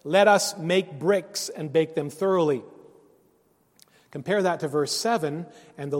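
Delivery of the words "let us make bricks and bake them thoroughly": loud, emphatic call to build; rally the crew with each word punched; rallying cry. Acted. Builders of the Tower of Babel urging each other, rallying cry. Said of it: "the rallying cry".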